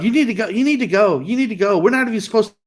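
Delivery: pressing, hurried retold order to leave; talking loud. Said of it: "she's still talking loud".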